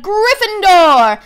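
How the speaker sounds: loud, high, drawn-out call of the house; booming Sorting Hat proclamation; hat shouting. A narrator's reading. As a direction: announce it to the whole hall. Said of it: "When it finally shouted".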